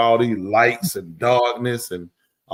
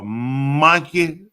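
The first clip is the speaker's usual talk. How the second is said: MONKEY stretched out very slowly on its first syllable; savored word. Shown as a demonstration. Host prefers the English word, slow drawn-out monkey. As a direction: relish MONKEY, dragging out the first vowel. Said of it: "But I like monkey".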